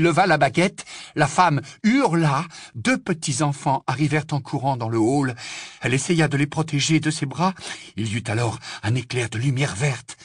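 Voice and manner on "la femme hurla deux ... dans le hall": usual speaking voice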